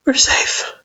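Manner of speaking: high, trembling whisper; shaky whisper. A narrator's reading. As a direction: whisper it shakily, still scared. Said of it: "Frisk repeated shakily".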